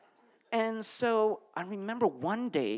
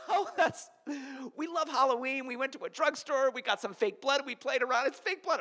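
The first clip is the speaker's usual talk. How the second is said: higher, panicky laughing excuse; frantic high cover story; 9 semitones higher than usual. Retold or imitated. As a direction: babble the lie in panic. Panicked lie to mom.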